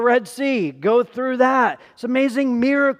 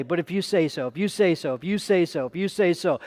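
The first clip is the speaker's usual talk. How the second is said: repeated softly; 5 semitones lower, 5 dB quieter than usual. Shown as a demonstration. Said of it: "every day".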